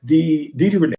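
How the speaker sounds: usual speaking voice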